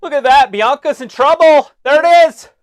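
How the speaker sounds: excited shout. Real, not acted. Friend breaks in that Bianca's in trouble, excited high shout. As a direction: yell it out like a ringside onlooker. Exclaiming over trouble unfolding.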